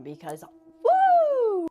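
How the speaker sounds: a drawn-out, high, loud ghostly woo; spooky woo. Acted. Storyteller spooking her viewers with a long ghostly woo. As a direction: hoot it like a haunted house.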